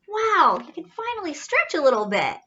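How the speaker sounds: high, breathy gasp into a bright wow; delighted gasp and wow. Acted. Reading aloud, amazed as the wings fan out. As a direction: marvel at the wings spreading.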